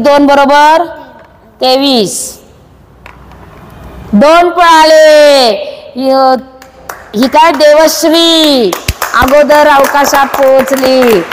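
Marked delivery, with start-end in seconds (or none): 4.1-11.2 slow, stretched-out call; drawn-out announcement. Real, not acted. Teacher announcing that Devashree reached the goal first, drawn-out call. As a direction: draw each word out long, calling it out.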